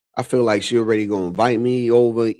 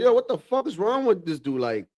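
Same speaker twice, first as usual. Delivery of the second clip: higher mock-outraged father; exasperated dad; 8 semitones higher than usual. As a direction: play the dad blaming the mother. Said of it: "My dad would be like".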